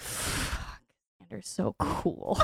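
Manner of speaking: breathless whisper to herself, starstruck; whispered aside. Retold or imitated. Barista to herself watching cool Xander, a starstruck whisper. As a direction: hush it like nobody should hear.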